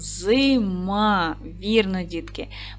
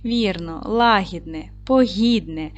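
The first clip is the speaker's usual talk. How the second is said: a slow poem reading with long pauses between words; slower than usual. Acted. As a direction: read the little poem gently to the children, slowly. Reads a short poem. (повільне, ніжне читання віршика)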